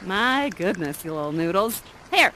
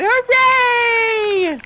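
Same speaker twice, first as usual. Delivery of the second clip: mock hooray; 11 semitones higher, 4 dB louder than usual. Cheering the betrayed group.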